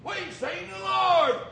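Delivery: loud, slow, joyful proclamation; excited announcement. Acted. Apostles telling Thomas excitedly they saw the Lord. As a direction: announce it with wonder. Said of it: "the apostles told him".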